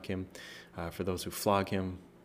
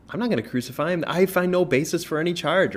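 higher, louder voice for Pilate, protesting; Pilate refusing; 9 semitones higher, 5 dB louder than usual. Retold or imitated. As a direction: argue back as the governor, up high. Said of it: "but Pilate says".

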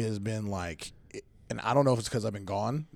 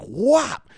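sharp whop; 12 semitones higher, 8 dB louder than usual. Retold or imitated. Imitated sound while chasing kid.

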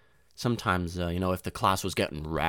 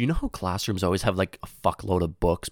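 ordinary talk

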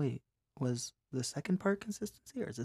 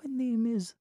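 Winnie the Pooh; 11 semitones higher, 6 dB louder, slower than usual. Shown as a demonstration. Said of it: "Winnie the Pooh?".

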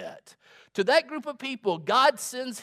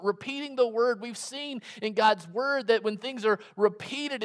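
ordinary talk

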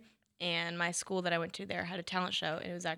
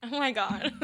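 laughing; 5 semitones higher, 6 dB louder than usual. Real, not acted. Just ate hot jelly bean.

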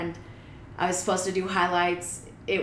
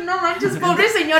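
high, louder, laughing protest; amused disbelief; 9 semitones higher, 6 dB louder than usual. Retold or imitated. Storyteller pities the man who complained, high laughing disbelief. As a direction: laugh through it in mock pity, pitched up.

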